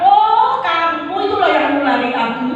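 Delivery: sudden high, loud outburst of blame; mock accusing cry. Sick man blaming the friend who infected him, mock accusing cry. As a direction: pitch it up and point the finger.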